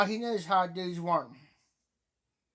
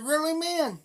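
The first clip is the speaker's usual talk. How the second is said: put-on child voice; 9 semitones higher than usual. Voicing granddaughter's disbelief.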